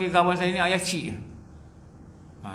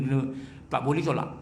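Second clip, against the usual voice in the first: usual speaking voice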